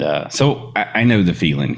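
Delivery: usual speaking voice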